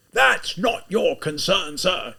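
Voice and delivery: slow, high, forced loud cry; unnaturally loud. Acted. Taken-aback lieutenant crying out at the student, forced and loud. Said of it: "in an unnaturally loud voice".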